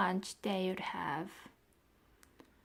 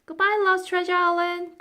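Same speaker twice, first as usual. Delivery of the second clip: high, loud farewell call; high loud girl's call; calling goodbye; 10 semitones higher, 11 dB louder, faster than usual. A narrator's reading. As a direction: wave and call out across the water. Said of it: "cried Florentine as they waved".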